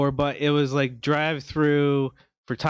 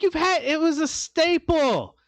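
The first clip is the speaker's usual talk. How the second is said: high, pleading protest; complaining to Taco Bell, high and aggrieved; indignant complaint; 12 semitones higher than usual. Retold or imitated. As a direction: plead it like a betrayed fan. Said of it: "And I'm like".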